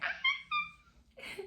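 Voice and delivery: short high scream, played for laughs; hammed-up high shriek; mock labor scream. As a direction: imitate yourself screaming in labor.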